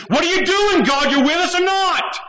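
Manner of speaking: high mocking whine of the grumblers; mock their complaining in a shrill voice; whiny Israelites. Preacher mocking the Israelites complaining against God, high whiny voice. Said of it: "They were saying".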